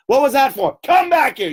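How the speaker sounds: high, loud furious scolding; mock furious teacher; angry teacher. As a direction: snap at the pupil to come back. Teacher scolding strapped pupil.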